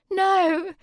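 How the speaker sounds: high, loud anguished no; moaning no. A narrator's reading. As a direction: plead in distress. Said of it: "Sammy moaned".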